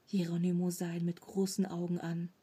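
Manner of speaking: usual speaking voice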